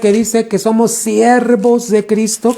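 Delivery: high, sustained preaching pitch; fervent preaching. Real, not acted. Preacher asking the church what a servant of Christ does, fervent high exhortation. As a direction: press the point home, pitched well above normal talk.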